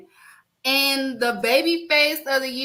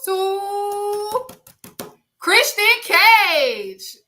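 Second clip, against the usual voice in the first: sung-out, loud, slow announcer reveal; 6 semitones higher, 4 dB louder, slower than usual. Acted. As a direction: stretch the name like a ring announcer. Announcing heel of the year.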